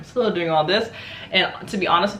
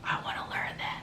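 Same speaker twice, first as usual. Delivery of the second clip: a very soft, breathless whisper; hushed childlike whisper of longing; awed whisper; 12 dB quieter than usual. Retold or imitated. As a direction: whisper it like a kid in awe. Remembering watching wushu as a kid.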